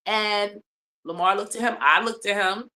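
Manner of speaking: ordinary talk, nothing marked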